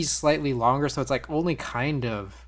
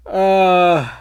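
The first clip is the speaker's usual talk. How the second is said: long, loud groan, higher then falling; loud drawn-out "uh"; dismayed groan; 5 semitones higher, 11 dB louder than usual. Real, not acted. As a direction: let out a big groan of defeat. Groans after losing the race.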